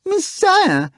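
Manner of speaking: very high, loud mock-horrified howl; a hammed-up high, loud cat howl. A narrator's reading. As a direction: wail as if mortified. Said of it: "howled the cat".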